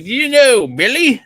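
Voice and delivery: put-on a pub regular greeting a Canadian in a weak Irish accent; mock Irish accent. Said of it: "I, I can't do the accent".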